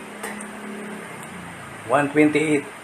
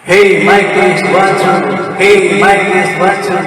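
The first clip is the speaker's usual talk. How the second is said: karaoke echo; 8 dB louder than usual. Shown as a demonstration. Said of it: "maganda to para mag-karaoke tayo".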